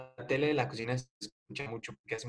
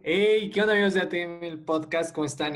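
podcast host intro; 5 semitones higher, 7 dB louder than usual. Acted.

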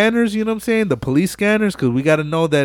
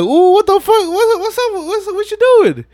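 much higher, louder, squealing disbelief; shocked crowd; 5 dB louder than usual. Retold or imitated. Racers calling out the cheater, high shocked yelp. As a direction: hit it like everyone caught him cheating. Crowd reacts to the false start.

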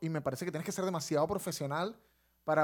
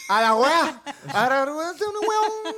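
loud, high caricature of Chilean; hammed-up Chilean accent climbing high; mock Chilean accent; 8 semitones higher, 5 dB louder, much slower than usual. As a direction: slur it into sing-song Chilean gibberish. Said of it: "No, el chileno no se entiende".

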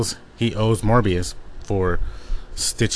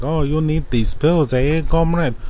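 put-on a clumsy, half-hearted German accent, pitched higher; a mock German villain taunting in a poor German accent; bad German accent; 7 semitones higher than usual. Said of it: "'Cause he's German. I can't do a German accent".

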